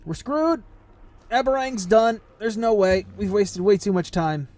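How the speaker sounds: high-pitched, exasperated groaning over lost time; dismayed outburst. Real, not acted. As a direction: start very high, then ease down in despair. Dismayed at days lost in game.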